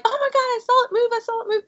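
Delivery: put-on high, fast kid chatter; high excited kid voice; imitating excited kids. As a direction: gush like a spooked child. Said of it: "most of the kids were like".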